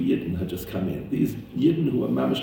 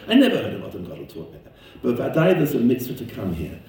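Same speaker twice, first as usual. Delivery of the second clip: normal talking voice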